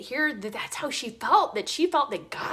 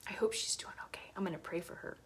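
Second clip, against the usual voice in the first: whispered, much softer; emotional whisper; 6 semitones lower, 12 dB quieter, faster than usual. Real, not acted. Hoping the woman she hurt is okay, whispered. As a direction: say it barely audible, moved.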